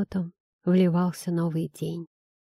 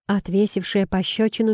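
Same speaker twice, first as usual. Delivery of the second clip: ordinary delivery, nothing marked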